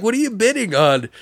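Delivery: high curious voice. Retold or imitated. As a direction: ask innocently. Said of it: "He's like".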